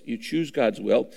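normal talking voice

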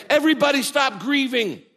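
high, loud boastful proclamation; mock show-off announcement. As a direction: brag about the miracle before it happens. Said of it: "He doesn't say".

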